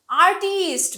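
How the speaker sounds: Artist with loud, long second syllable, ar-TEEEST; Bengali-style stress. Shown as a demonstration. Teacher imitating Bengali speakers' mistake, artist as ar-TEEEST. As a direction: drag out the টিস্ট in artist. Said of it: "অনেকেই আমরা বাঙালিরা".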